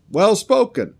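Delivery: high, loud, eager cry of approval; the crowd shouts its approval, high and keen. Acted. Crowd hails Elijah's challenge with an eager, high cry.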